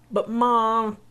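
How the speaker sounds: put-on a kid whining at his mom; pleading boy.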